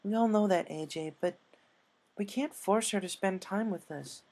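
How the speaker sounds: lightly voiced friend.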